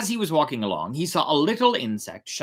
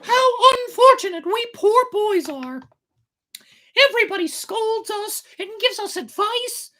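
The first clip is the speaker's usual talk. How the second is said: very high puppet voice grumbling to himself; high puppet voice, self-pitying grumble; 6 dB louder than usual. A narrator's reading. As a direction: sulk and complain like a wronged boy. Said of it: "said the puppet to himself".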